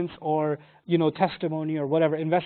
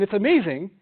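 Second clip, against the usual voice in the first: ordinary talk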